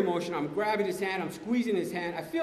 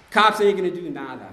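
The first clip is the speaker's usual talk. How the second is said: hard outburst; 9 dB louder than usual. Retold or imitated. Drunk resolve to seek revenge.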